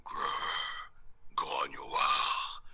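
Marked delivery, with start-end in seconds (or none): 0.0-0.9 low whispered growl; werewolf growl. Acted. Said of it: "Imite le loup-garou qui grogne".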